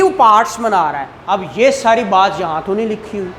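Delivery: ordinary delivery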